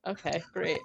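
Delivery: soft, underwhelmed reaction; quietly resigned aside; understated deadpan. Retold or imitated. Her deadpan reaction to gross scene.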